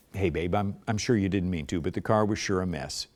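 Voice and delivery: soft, mild, low-key; minimum emotion. Shown as a demonstration. Spouse gently correcting, soft and calm. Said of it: "give it with minimum of emotion".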